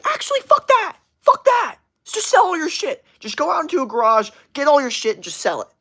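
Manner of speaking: high, loud, ranting guru imitation; hammed-up hustle rant, way up high and forceful; Gary Vee impression. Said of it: "The first one to be like".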